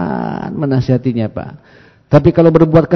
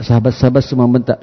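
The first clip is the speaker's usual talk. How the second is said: ordinary delivery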